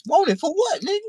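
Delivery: incredulous question. Retold or imitated. The teller answering guys on the block, incredulous. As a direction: snap back, not believing it.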